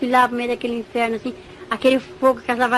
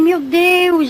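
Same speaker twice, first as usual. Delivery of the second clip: high, louder cry to God, drawn out; retold desperate exclamation; 6 semitones higher, 5 dB louder than usual. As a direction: call on God in dismay. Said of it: "E eu fiquei naquele desespero". (exclamação de desespero, recontada)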